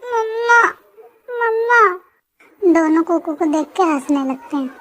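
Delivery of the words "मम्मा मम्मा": put-on high whiny child calling mamma in his sleep; a little kid crying out in his sleep; baby whimpering for his mother; sleepy toddler. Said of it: "Kuku नींद में बोलता है".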